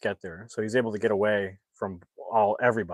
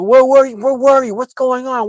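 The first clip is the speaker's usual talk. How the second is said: much higher and louder, demanding answers; pissed-off shout; 14 semitones higher, 11 dB louder than usual. Retold or imitated. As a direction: scream the questions at him. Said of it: "Hank is pissed".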